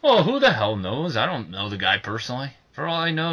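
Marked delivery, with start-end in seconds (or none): none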